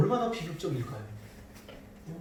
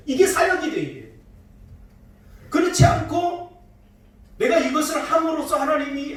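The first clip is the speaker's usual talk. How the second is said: higher, louder pulpit fervour with bursts of laughter; rising fervent preaching; 8 semitones higher, 3 dB louder than usual. Acted. Pastor urging the congregation to receive grace, fervent preaching. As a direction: drive the point home to the congregation.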